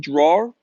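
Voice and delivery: "Drawer" for draw, with an R added at the end; New England accent. Retold or imitated. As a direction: imitate a New England teacher saying "Drawer". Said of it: "all my teachers had, they said".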